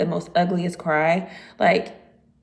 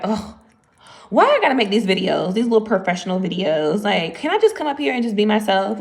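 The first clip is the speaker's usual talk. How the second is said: louder, grumbling mock complaint; whole attitude; 5 dB louder than usual. Vlogger griping about having to make professional videos, with attitude. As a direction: play up the reluctance with sass. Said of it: "a whole attitude in these videos".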